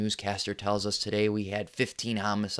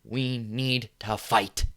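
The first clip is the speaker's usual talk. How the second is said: solemn resolve; 3 dB quieter, slower than usual. Acted. Slow solemn call to fight.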